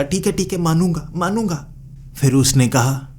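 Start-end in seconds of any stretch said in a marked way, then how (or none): 0.0-1.7 high-pitched, acted surrender after a slap; giving in. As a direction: agree in a much higher voice, giving in. Gives in after being slapped. (हार मानते हुए, ऊँची आवाज़ में)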